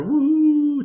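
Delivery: high whoop of mock celebration; cheering woo. Sheep cheering their shepherd goodbye, high whoop. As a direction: cheer the send-off like a party.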